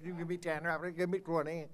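fast, lightly voiced auctioneer patter. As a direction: rattle it off like an auctioneer. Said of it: "you gotta sound like an auctioneer".

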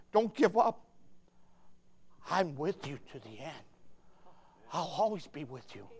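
soft, low voice of comfort; tender reassurance. Acted. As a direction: speak God's promise gently, dropping very quiet. Voices God comforting Annie.

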